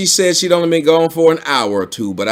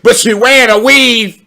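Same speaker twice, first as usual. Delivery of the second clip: mock gossip; 10 semitones higher, 6 dB louder than usual. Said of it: "You don't hear me say it".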